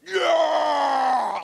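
long, high, very loud roar; freaking-out yell. Retold or imitated. Preacher mimics the congregation freaking out, wild drawn-out yell. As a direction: imitate a crowd going wild. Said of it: "Like, freaking out".

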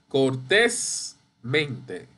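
cortésmente very slow, TÉS stressed and held. Shown as a demonstration. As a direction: draw out cor-TÉS, pause, then mente. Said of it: "Eso es sobre esdrújula".

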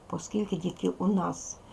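ordinary talk

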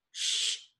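breathy hushing sound; Mandy hushing James at a stranger's door, whispered; shushing. A narrator's reading. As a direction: hush him, no voice at all.

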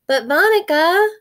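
put-on high, loud little-boy voice; a small boy tugging at his babysitter; little boy calling. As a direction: call out like an eager small child. Little brother calls the babysitter.

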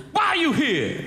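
high, put-on convict demand; demanding prisoner. Prisoner questions bowl-cut kid, high mock demand. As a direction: fire the question in a high-pitched character voice.

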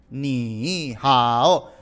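"ni hao" with both syllables dipping and rising; stilted "ni hao", full low dip on each syllable; unchanged third tones. Shown as a demonstration. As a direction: drag "ni" and "hao" each through a falling-rising tone. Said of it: "me escucharon cuando yo dije".

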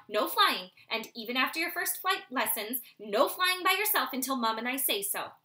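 loud, bossy father owl; stern father. Acted. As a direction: coach him firmly like a strict dad. Father drills owlet in branching.

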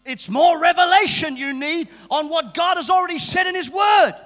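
high shouted preaching with halting build-up; full-force preaching. Real, not acted. Preacher urging the congregation to seek revelation, full-force shout. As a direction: hammer it out at the top of your voice.

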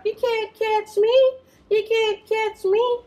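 high squeaky singsong tease; taunting monkey. Acted. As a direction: sing it up high like a cheeky little monkey. Monkey taunts the crocodile in rhyme.

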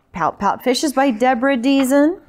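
ordinary delivery

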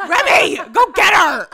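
shouting at Remy a second time. Retold or imitated. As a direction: yell it out, losing patience. Repeated louder, shouting.

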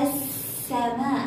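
"السماء" slow as as-samaa, no L; silent lam. Shown as a demonstration. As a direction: hold a long doubled س in السماء. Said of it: "هل لفظنا اللام؟".

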